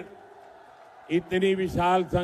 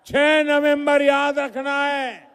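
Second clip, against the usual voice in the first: shouting; 6 semitones higher, 5 dB louder, slower than usual. Real, not acted. Rally speaker rousing the crowd.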